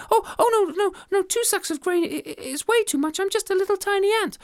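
very high, flustered refusal; too small for such a gift; squeaky modest ant; modest tiny ant. Acted. Ant refuses big reward.

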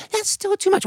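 very high tiny voice; a tiny ant politely refusing; squeaky modest no. Acted. Ant declines.

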